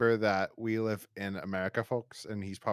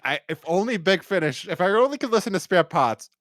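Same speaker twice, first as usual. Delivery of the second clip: higher, louder, hammed-up longing; mock yearning, pitched up and loud; mock pleading wish; 7 semitones higher, 6 dB louder than usual. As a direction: play a fan begging for the correct theme. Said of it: "So if you really are like".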